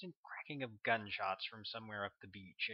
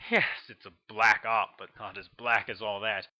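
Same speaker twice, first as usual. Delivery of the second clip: louder, commanding officer laying down the line; forceful colonel; 7 dB louder than usual. A narrator's reading. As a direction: press the point like a commander. Colonel voiced in story.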